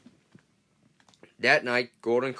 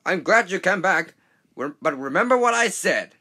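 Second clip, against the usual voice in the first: loud, higher parting shout; 7 semitones higher, 8 dB louder than usual. Acted. Big engine shouting a warning as he leaves. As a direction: keep calling out over the noise. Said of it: "shouted Gordon".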